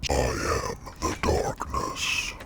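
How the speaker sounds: slow, whispered demon growl; demonic layered voice. Acted. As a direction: intone it like a demon from the dark. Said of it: "talking in a demon voice".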